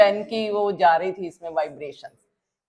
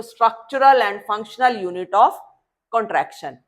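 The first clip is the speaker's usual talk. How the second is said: slow, spaced-out definition; slow dictation; much slower than usual. Shown as a demonstration. Teacher giving an alternative definition, slow and deliberate. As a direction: lay each word out for note-taking. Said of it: "या तुम ऐसे भी बोल सकते हो".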